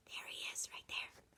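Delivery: hushed excited whisper. Acted. Reader whispering to the kids where he is hiding. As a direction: point him out in a whisper.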